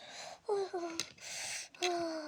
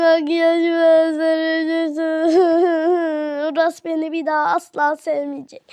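sobbing lament; 7 dB louder than usual. Acted. Sister mourning her ruined makeup, theatrical crying. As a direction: wail the words through mock sobs.

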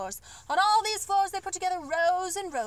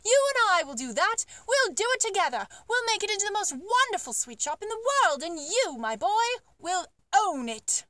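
higher and louder, eager promise; excited Duke; 5 semitones higher, 4 dB louder than usual. Acted. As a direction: swell with delight as the plan grows. Duke promises to build shop.

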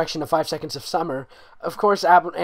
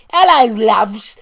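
hammed Cockney accent, much higher and louder; 8 semitones higher, 15 dB louder, much slower than usual. Reader voicing Harry in a hammed Cockney accent. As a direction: drawl hello loves in Cockney. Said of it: "He's gonna be Cockney".

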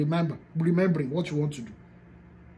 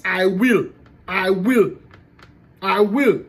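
louder, firm insistence on "I will"; emphatic I will; 5 dB louder, slower than usual. Shown as a demonstration. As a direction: punch "I will" like a command. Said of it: "You have to be emphatic".

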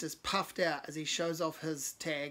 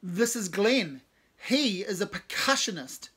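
louder, slow proud introduction; proud call; 4 semitones higher, 8 dB louder, slower than usual. Acted. Cave introducing Glenn to the musicians, proud call. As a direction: present him to the room proudly. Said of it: "proudly calls out".

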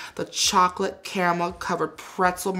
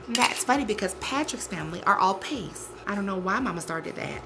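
ordinary talk

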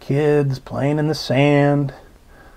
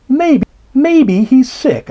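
put-on worried child; 9 semitones higher, 4 dB louder, slower than usual. A narrator's reading.

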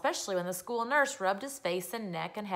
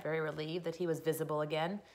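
plain reading voice

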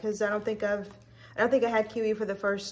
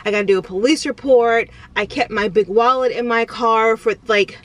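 loud, rushed replay of her panic; retold panic; 7 dB louder than usual. Vlogger telling viewers about her stolen car scare, loud retold panic. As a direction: replay the moment you thought your car was stolen. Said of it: "immediately I start freaking out".

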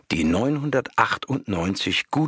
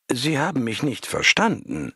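energetic; 7 semitones higher than usual. A narrator's reading. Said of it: "sagte sie energisch".

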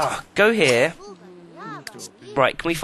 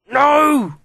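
sudden high, loud shout of protest; frustrated yell; 11 semitones higher, 8 dB louder than usual. Real, not acted. Gamer yelling at stubborn furniture, loud frustrated shout.

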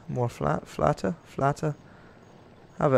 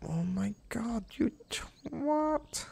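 breathless high whisper of an insult; 10 semitones higher, 9 dB quieter, much slower than usual. Real, not acted. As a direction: hiss it at the screen under your breath. Insulting a game animal under breath.

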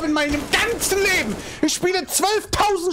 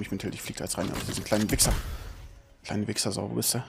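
quiet tilted muttering; 10 dB quieter, faster than usual. Real, not acted.

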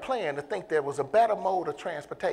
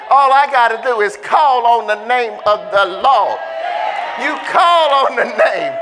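loud, rising preacher's shout; preaching at full force; 4 semitones higher, 8 dB louder than usual. Acted. Preacher firing up the church, at full shout. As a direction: whoop it up to the congregation.

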